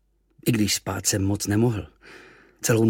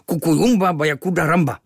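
high, louder sulky native words; frowning; 7 semitones higher, 7 dB louder than usual. Acted. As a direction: grumble it with a scowl. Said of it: "Mračil se Kvíkvék".

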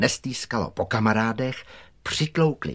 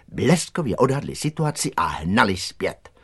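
plain reading voice

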